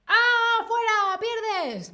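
high, loud "fuera" as if catching a player; mock referee shout; playful shout. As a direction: call them out of the game. Acts out catching a mover.

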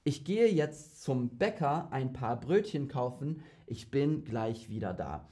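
careful Hochdeutsch demo; standard High German. Shown as a demonstration. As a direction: clear standard German read. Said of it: "Let me just show you how different the dialects can be".

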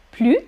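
"Plus" as plu, no S; short "Plus" ending on the vowel; silent final S. Shown as a demonstration. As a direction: quiz option: "Plus" with its S dropped. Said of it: "on ne prononce pas le S".

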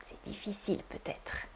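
soft whispered aside. Real, not acted. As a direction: whisper it to yourself. Aside to class. (aparté chuchoté)

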